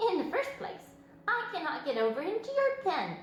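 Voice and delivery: high, prim lamb voice; snooty lamb. A narrator's reading. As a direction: look down your nose at the pig. Lamb's speech.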